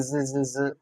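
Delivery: ordinary delivery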